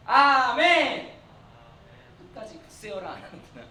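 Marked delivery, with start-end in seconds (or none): 0.1-1.2 loud, drawn-out amen; big loud amen. Shown as a demonstration. Preacher calls on the boys to answer, big loud drawn-out amen. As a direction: belt out a long model amen. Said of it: "크게".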